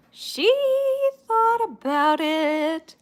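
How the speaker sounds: high, drawn-out tease; teasing singsong. Real, not acted. Owner teasing her sulking dog, singsong. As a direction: stretch it out slowly in a high lilt.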